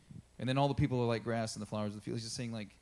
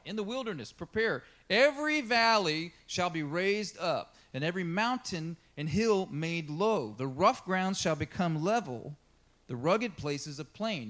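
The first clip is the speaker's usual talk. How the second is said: raised, slowed proclamation of the verse; scripture reading; 5 semitones higher, 5 dB louder than usual. Acted. Reads scripture aloud.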